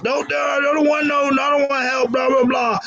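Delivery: high-pitched whiny drunk imitation; mock drunk friend. Drunk friend arguing with ER nurses, mocking high voice. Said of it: "being a drunk butthole".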